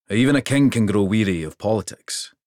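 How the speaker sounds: a big king pleased with the trip; hearty king. Acted. King's voice.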